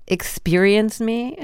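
drawn-out mock grandeur; mocking quote. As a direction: lean in to mock the self-absorption. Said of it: "that last line says so much".

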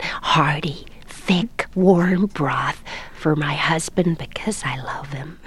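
hushed, slow commercial sincerity; heartfelt sincerity. Acted. Elderly actress selling soup on camera, hushed and sincere. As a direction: whisper it slowly, with earnest devotion to the camera. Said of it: "it's so sincere, and they look at the camera".

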